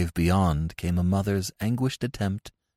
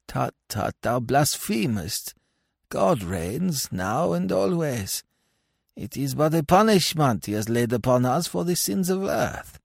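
reproving pious voice; 9 semitones higher than usual. Acted. Pious corpse rebukes blasphemer, high measured reproof. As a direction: scold the blasphemer in a raised, measured voice.

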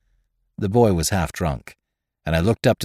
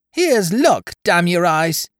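put-on much higher, piercing boyish toast; shrill boy's voice; 8 semitones higher, 3 dB louder, slower than usual. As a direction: shriek the toast like a wild boy. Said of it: "shrilled the boy's voice".

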